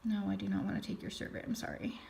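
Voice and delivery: very soft, quick aside; barely audible, hurried refusal trailing into near whisper; muttered aside. Real, not acted. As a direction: brush it off under your breath. Aside dismissing a survey pop-up.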